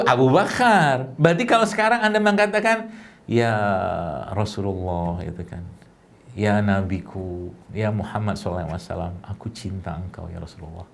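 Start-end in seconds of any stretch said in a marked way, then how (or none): 3.3-10.8 tender longing. Shown as a demonstration. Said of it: "ngomongnya syahdu gitu ya".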